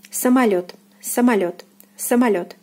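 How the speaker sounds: самолёт as "sa-ma-LYOT", stress on ё; careful falling model самолёт; unstressed O as A. Shown as a demonstration. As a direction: say самолёт with о turned to "a". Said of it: "So O is unstressed here".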